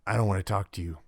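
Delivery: understated, guarded brush-off; lightly voiced character shutting down the conversation; unforthcoming NPC. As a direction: decline to talk, with no show. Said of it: "if all you get from a character".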